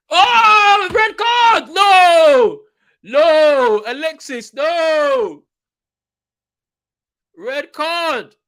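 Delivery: real despairing yell; anguished shout. Real, not acted. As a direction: scream at the screen as your player is sent off. Red card for his team.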